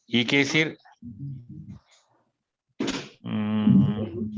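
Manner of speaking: ordinary talk, nothing marked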